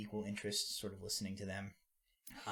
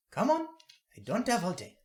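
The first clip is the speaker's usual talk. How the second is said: put-on much higher, louder impatient old woman; impatient granny; 10 semitones higher, 11 dB louder than usual. Granny telling the boy to hurry, higher and louder. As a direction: hurry him along.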